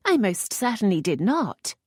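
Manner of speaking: indignant lady, not overplayed; lightly voiced indignant lady. A narrator's reading. Lisette denying to the earl, mildly indignant.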